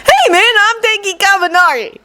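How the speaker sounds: put-on high, friendly young guy; upbeat self-introduction. Kaminari introducing himself.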